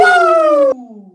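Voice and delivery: high, loud wrestler woo; Ric Flair woo. Retold or imitated. Hosts imitating Ric Flair's trademark call, piercing woo.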